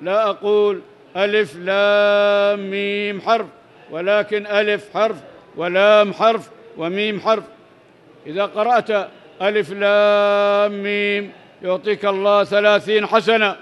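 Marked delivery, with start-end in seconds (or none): 1.2-3.2 long, sustained Quranic letters; drawn-out recitation. Shown as a demonstration. Reciting the letters to explain the reward, elongated recitation. 9.4-11.3 long, sustained Quranic letters; drawn-out recitation. Shown as a demonstration. Reciting the letters to explain the reward, elongated recitation.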